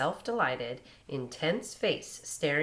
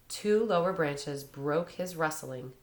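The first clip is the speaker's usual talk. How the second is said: ordinary delivery, nothing marked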